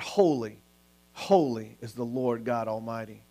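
low, soft, reverent quote; hushed retold worship. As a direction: drop down low and quiet, in awe. Retold angels' cry, now hushed.

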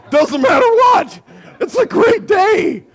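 very high, loud mock-ecstatic cry; overjoyed shouting. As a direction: whoop it out, drawing out the ending. Acts overjoyed husband.

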